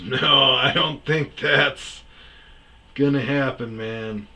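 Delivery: loud, drawn-out no with a laugh; amused refusal. Retold or imitated. Man tells the patient she won't go out with him, amused. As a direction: imitate him chuckling out a big slow no.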